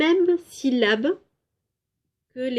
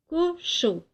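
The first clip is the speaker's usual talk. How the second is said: cochon split as co... chon, pitched high; a short break inside cochon, co then chon; first syllable isolated; 7 semitones higher than usual. Shown as a demonstration. Teacher comparing two words for pupils, cochon split in two. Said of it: "commencent par la syllabe co".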